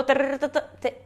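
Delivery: T launching a tongue trill, repeated; trill practice. Shown as a demonstration. Teacher showing how to pull out the trill, repeated rolling. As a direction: push T into rolled R bursts. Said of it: "Tipo, vou puxando".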